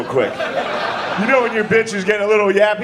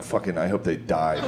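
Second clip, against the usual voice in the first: deadpan; 13 semitones lower, 7 dB quieter than usual. Retold or imitated. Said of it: "I'm like".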